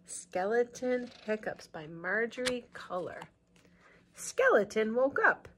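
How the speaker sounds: put-on slow storybook reading for kids; picture-book narration; read-aloud narration. A narrator's reading.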